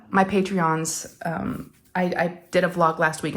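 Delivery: ordinary delivery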